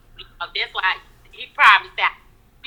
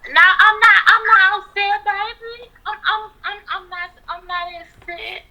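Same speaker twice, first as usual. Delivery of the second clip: much higher, half-crying protest; whiny denial; 9 semitones higher, 3 dB louder than usual. Pretend. Denies to the host she's upset, mock tearful and high. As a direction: wail that she isn't upset, voice breaking. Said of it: "why is she so angry".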